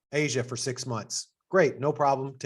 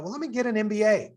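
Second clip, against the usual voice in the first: high, breezy mock-naive thought; naive young self; 8 semitones higher than usual. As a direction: voice your clueless younger self lightly and high. Naive young self deciding MBA.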